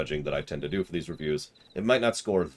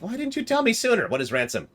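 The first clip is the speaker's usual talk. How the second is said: squeaky alarm; 3 dB louder than usual. Acted. Voicing Gigu's alarm.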